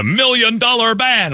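hammed-up, high-pitched announcer; mock announcer. Host mocking the band's grand name, mock announcer. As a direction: belt out the billing in a high mocking voice.

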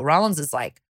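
usual speaking voice

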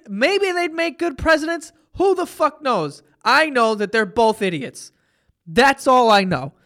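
ordinary talk

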